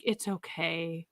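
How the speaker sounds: soft, slow, low consoling murmur; imitated soothing reassurance. Podcaster mimicking characters consoling Sam, soft reassurance. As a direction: gently hush someone's guilt, as a mimicry.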